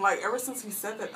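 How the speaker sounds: ordinary talk, nothing marked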